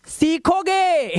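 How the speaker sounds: सीखोगे stretched slowly and falling; playful drawn-out promise; drawn-out tease. Shown as a demonstration. Teacher teasing a curious student, slowly.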